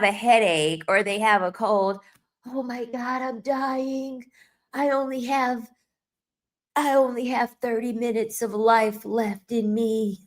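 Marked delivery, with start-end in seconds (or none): 2.5-10.2 mock dying man. Mocking a man with a cold, hammed-up dying whine. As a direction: ham up a dramatic sick man, breathy and pitched up.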